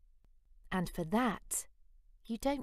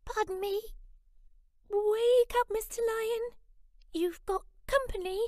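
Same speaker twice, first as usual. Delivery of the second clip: very high squeaky little mouse voice; a tiny nervous mouse asking bravely; timid mouse; 5 dB louder than usual. Acted. Mouse asking the sleeping lion to teach him roar, squeaky.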